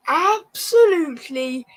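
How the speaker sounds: slow, loud anguished cry of "kill me"; fan's despairing howl. Real, not acted. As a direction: wail in real despair. Despair at a foul.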